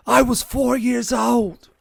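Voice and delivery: high, loud, slow mock Aussie; Australian accent. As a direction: ham up a broad Australian accent, drawn out. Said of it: "I can't do an Australian accent".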